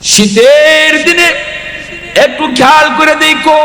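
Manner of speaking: high, ringing preacher's chant; fiery sermon. Acted. Preacher exhorting the crowd to care for mothers, high chanted call. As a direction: lift the crowd in a soaring chant.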